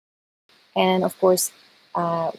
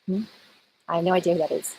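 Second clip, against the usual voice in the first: usual speaking voice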